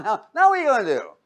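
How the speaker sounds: normal talking voice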